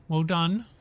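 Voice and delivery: normal talking voice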